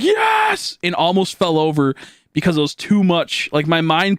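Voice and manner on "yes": a sudden high, mock-victorious cheer; triumphant yes. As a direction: celebrate a tiny win like it's huge. Triumph after peeing successfully.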